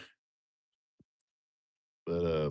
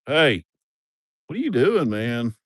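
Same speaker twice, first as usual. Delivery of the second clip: startled shout; 3 semitones higher, 9 dB louder than usual. Real, not acted. Startled as animal jumps at him.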